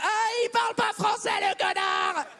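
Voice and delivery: high, sneering jab; mocking scorn. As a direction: sneer at the sender's error. French reader mocking a typo. (moquerie méprisante, aiguë)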